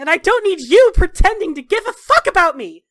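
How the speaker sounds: high, loud, bitter tirade; furious rebuff; angry shouting. Acted. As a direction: lash out at their concern. Acted quarrel, rebuffing concern.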